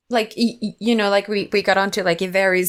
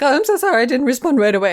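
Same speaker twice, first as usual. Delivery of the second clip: flustered apology; 7 semitones higher, 4 dB louder, faster than usual. Retold or imitated. Colleague apologising, quoted.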